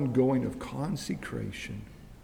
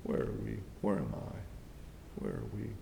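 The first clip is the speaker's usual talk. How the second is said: a low, much softer whispered question; 7 semitones lower, 9 dB quieter than usual. Real, not acted. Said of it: "self-questioning in sermon".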